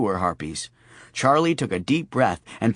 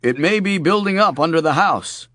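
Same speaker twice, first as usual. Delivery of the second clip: gas company voice; 5 semitones higher, 4 dB louder than usual. Acted. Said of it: "his best gas company voice".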